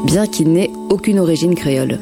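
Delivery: usual speaking voice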